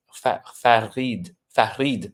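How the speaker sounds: put-on slow French-accented try at Farid; imitated French accent. Host showing how Montreal says his name, French accent. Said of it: "with a French accent".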